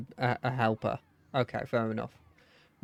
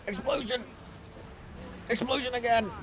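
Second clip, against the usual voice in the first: high gleeful cry; excited shout; 10 semitones higher, 5 dB quieter than usual. Real, not acted. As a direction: shout it with glee. Excited cheer at explosions.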